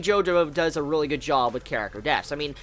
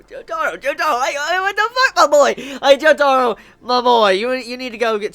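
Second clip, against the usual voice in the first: much higher, louder outraged calling out; exasperated yelling; 7 semitones higher, 5 dB louder than usual. Real, not acted. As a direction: call him out like a let-down friend. Scolding the character in exasperation.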